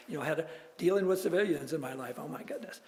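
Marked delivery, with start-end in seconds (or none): none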